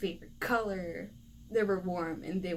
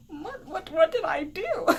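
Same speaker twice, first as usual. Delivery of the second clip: high mock-crying plea, breaking into laughter; hammed-up whimper of innocence, rising louder; tearful bewildered protest; 8 semitones higher, 4 dB louder than usual. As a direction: stammer it like a wronged kid near tears. Child protests after the scolding.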